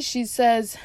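ordinary talk

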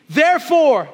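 loud, rallying call to recite; leading a recitation. Real, not acted. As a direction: shout it so the crowd joins. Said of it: "Say with me".

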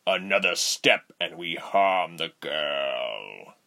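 low, slow menacing threat; menacing villain. A narrator's reading. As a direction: warn her low and slow. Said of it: "Rubens warned Ladala".

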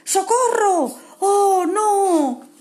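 high, loud panicked cry; cry for help. Acted. Kevin cries for help as the tree falls, panicked. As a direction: scream for help as the tree shakes.

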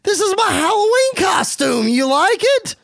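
high, goofy bro voice; Matt Riddle. Acted. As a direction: show off proudly like an excitable dude. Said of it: "Riddle says".

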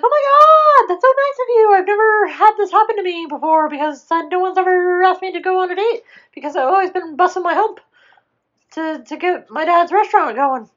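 very high, loud squealed thanks; hammed-up squeal tumbling into a fast ramble; breakneck gushing. Tiana thanks Naveen for the date, high excited gushing.

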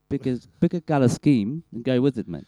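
put-on loud, emphatic South African accent. Griping about the flag to a mate, loud South African accent. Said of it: "The South African flag".

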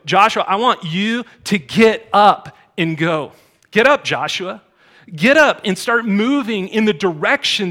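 loud, rising preacher's call to get up; rally the congregation like a coach; preaching at full force. Real, not acted. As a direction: pastor urging the church to rise and go, fervent preaching.

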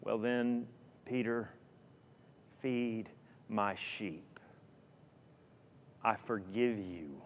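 soft, slow, tender forgiveness. Acted. Jesus forgiving Peter after breakfast, softly and tenderly. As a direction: forgive him gently, almost hushed.

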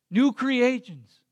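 slow, weighty closing declaration; slow proclamation. Acted. Pastor ending the sermon, slow weighty proclamation. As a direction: lay each phrase down slowly.

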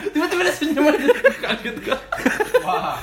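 high-pitched laughing words. Real, not acted. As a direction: squeal it through laughter. Laughing at a sudden smile. (bicara sambil tertawa)

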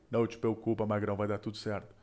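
calm the nervous guy down, teasing; mock reassuring drag queen. Drag queen soothing the nervous contestant, low soft reassurance.

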